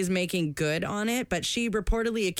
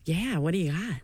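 whisper. Pretend. Playing the buyer.

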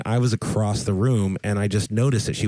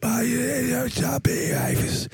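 high strained yeahs fading to breath; imitating lost voice; 13 semitones higher, much slower than usual. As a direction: push out yeahs with no voice. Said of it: "You're being like".

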